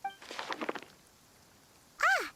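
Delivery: high startled "Ah!"; sudden high exclamation; surprised gasp. Acted. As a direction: catch your breath at the drawing. Sees the picture book page.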